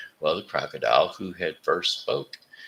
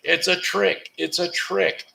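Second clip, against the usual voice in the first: animals yelling; 9 semitones higher than usual. Acted. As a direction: shriek the alarm like a furious crowd of animals. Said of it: "all yelling".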